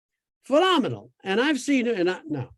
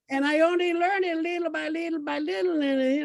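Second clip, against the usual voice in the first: laughing; 11 semitones higher than usual. Real, not acted. Laughing at his own accent.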